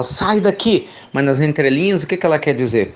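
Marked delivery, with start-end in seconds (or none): 0.0-0.9 mock yell. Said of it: "a pessoa tá gritando e fala".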